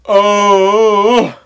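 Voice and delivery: normal talking voice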